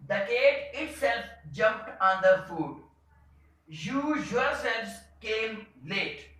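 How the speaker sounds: slow, stressing each pronoun; emphatic example reading. Shown as a demonstration. Teacher reading emphatic pronoun sentences to the class, slow with stress. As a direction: press on the pronoun in every sentence. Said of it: "stressing on my talk".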